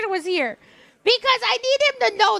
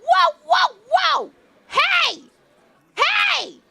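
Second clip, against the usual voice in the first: high-pitched, louder protest; retold scolding interjections; 8 semitones higher, 3 dB louder, much slower than usual. Streamer retelling how she stopped cranky Richard, high scolding calls. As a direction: cut them off with high, drawn calls.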